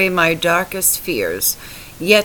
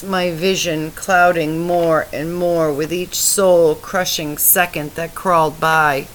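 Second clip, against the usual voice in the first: ordinary delivery, nothing marked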